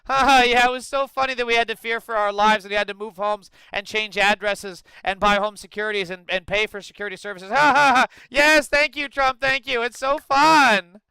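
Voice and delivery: loud, high mock laughing; hammed-up grateful cheer with fake ha-ha laughs; sarcastic fake glee. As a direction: play forced hilarity at full volume, dripping with sarcasm. Voices reporters laughing along, sarcastic.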